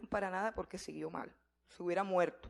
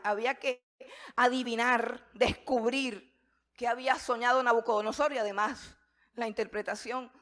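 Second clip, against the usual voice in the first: ordinary talk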